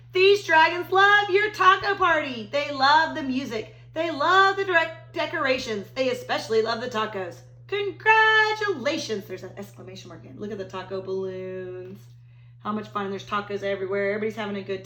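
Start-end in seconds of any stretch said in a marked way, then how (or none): 0.1-9.3 big, bright read-aloud voice, higher and louder; storybook read-aloud. Acted. Teacher reading to her class, big and animated. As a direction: read it like a party for the kids.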